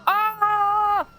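long, loud high-pitched scream; hammed-up scream held at the top of the voice; play-acted yell of alarm. Attacked in game, screams.

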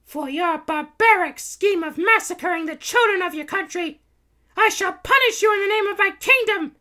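very high, loud heroine speech; proclaiming princess. A narrator's reading. Princess Kitty vowing to punish the Baron, proclaiming. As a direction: pronounce judgement as a cartoon princess.

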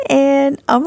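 ordinary talk, nothing marked

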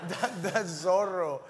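put-on high, slow child voice through laughter; little kid. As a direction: imitate a child correcting his mother, amused. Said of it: "the kid just looked at me".

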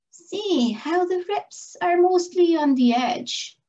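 a lightly voiced slender sedge making its case; arguing sedge. A narrator's reading. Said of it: "argued a golden and slender looking sedge".

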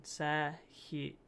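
ordinary talk, nothing marked

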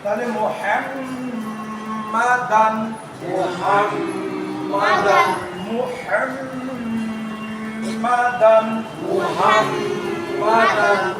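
মুহাম্মাদান very slow with a clear -দান; teacher chants the word again and again; slow tanween recitation. Shown as a demonstration. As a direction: draw মুহাম্মাদান out, ending on দান. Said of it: "দুই জবরের পরে".

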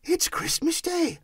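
high, thrilled realisation; overjoyed high voice; delighted self-talk. A narrator's reading. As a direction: exclaim to yourself in wonder. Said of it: "said Scrooge to himself".

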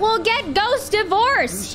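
high, drawn-out indignant cry; outraged retort. Real, not acted. Player refusing the ghost marriage, outraged. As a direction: cry it back slowly, pitch way up.

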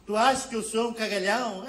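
mock Portuguese accent, squaring up. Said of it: "O próprio português, discutindo".

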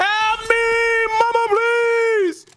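mock pleading. Begging while getting killed in game.

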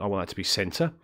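normal talking voice

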